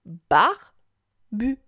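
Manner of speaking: "Barbu" as a loud "bar", long gap, then "bu"; syllable by syllable. Shown as a demonstration. Teacher asks child to listen, "Barbu" syllable by syllable. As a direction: break "Barbu" slowly into two syllables. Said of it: "Écoute".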